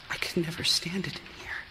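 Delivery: breathless whisper, fast, secretive. Acted. Wife confiding her dread of the room, whispered. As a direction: let the words slip out as a scared whisper.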